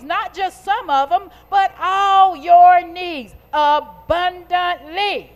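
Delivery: high, loud preacher's call, stretched at the peak; full-force preaching. Real, not acted. Preacher questioning the congregation on God's promises, rousing preaching.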